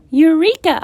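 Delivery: high, loud burst of discovery; eureka, a bright cry; shouted eureka. A narrator's reading. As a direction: shout it like a sudden idea. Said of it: "I shouted".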